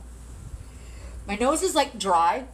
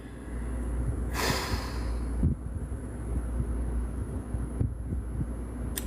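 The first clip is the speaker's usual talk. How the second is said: heavy sigh; 7 dB quieter than usual. Real, not acted. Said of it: "it gets me fired up".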